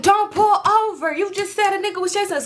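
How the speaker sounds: high-pitched, insistent protest; urgent warning. Retold or imitated. Friend warning the driver not to stop, urgent.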